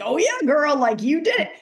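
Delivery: high, mock cheering oneself on; sassy self-congratulation. Talking to oneself as if always right, mock self-congratulation. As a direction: hype yourself up, playfully overdone.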